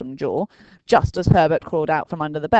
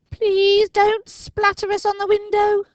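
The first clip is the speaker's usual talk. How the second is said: put-on high, slow pleading boy; begging; 5 dB louder than usual. A narrator's reading. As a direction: plead in fear. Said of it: "begged Dinking".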